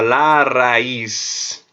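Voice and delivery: "la raíz" said slowly with Spanish sounds; Spanish word demo. Shown as a demonstration. Teacher modelling "la raíz" for students, slow and careful. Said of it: "o radical se chama raiz".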